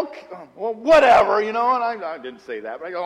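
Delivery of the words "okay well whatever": dismissive. Retold or imitated. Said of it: "And I go".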